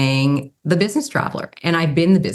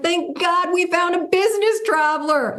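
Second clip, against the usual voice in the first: mock relieved clients. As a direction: ham up the panicked clients' relief.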